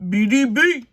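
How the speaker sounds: loud, punchy jingle-style call of the letters; odd announcer callout. Acted. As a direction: belt it out like a show slogan. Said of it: "I don't know why I just said it like that".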